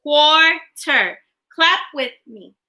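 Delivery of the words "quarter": "Quarter" broken into two clear beats; syllable break. Shown as a demonstration. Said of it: "Break words in two parts".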